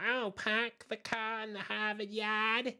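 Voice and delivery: put-on loud, high, drawn-out Boston accent, R's dropped; mocking Boston accent. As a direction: heckle in a hammed-up Boston accent. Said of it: "R's didn't exist in my vocabulary".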